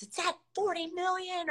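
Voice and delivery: high, thrilled reading of the message; high excited news; excited text. Retold or imitated. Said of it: "I was getting texts".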